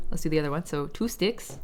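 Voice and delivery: normal talking voice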